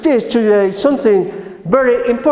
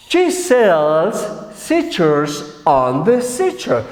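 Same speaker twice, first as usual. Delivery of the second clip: very slow, lower, phrase by phrase; tongue-twister model; 4 semitones lower, much slower than usual. Shown as a demonstration. Teacher asking the class to repeat, slow deliberate model. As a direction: draw out each word so learners can repeat.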